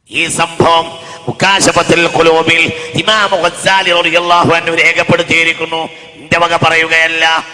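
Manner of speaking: loud, slow preaching; ringing sermon voice; full-force preaching. Acted. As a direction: preach it to the hall.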